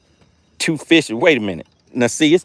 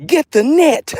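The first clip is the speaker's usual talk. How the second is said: high, loud excited quote; Phil; 13 semitones higher, 4 dB louder, slower than usual. Retold or imitated. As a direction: cry it out high, imitating someone urgent. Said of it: "Phil said".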